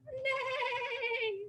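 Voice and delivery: high, soft, slow whinnying neigh; whinny it like a gentle unicorn; horse neigh. Pretend. Teacher demonstrates a unicorn's neigh. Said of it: "Make a unicorn sound".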